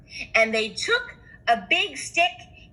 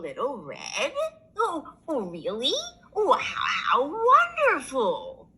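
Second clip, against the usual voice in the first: quavering grandma voice; an old lady pleased at the door; lightly voiced granny; 5 semitones higher than usual. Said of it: "said Grandma".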